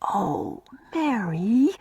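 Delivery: soft, unvoiced pleading whisper; tender whisper. A narrator's reading. Una tenderly pleading with sulking Mary. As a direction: coax her gently, barely voiced. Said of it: "pushing a tender arm".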